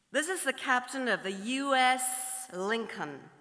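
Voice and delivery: lightly voiced American ship's captain; slight American accent. American captain hailing another ship, slight American accent. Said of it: "do a slight American accent".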